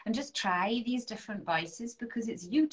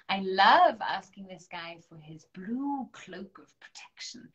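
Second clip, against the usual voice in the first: breathy whispered confidence; 6 dB louder than usual. Real, not acted. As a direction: lean in and whisper it like a secret. Whispering aside about Archangel Michael.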